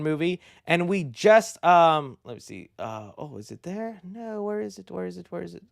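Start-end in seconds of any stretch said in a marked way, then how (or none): 2.2-5.6 much softer searching aside; quiet off-mic search; muttering to self. Real, not acted. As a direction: mumble while hunting for the clip.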